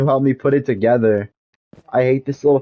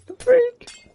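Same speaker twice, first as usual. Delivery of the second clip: incredulous. Real, not acted. Mocking disbelief at cousin's text.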